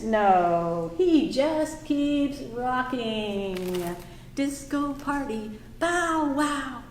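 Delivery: usual speaking voice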